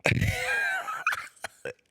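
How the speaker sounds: cracking up for real. Real, not acted. Laughing at his own story.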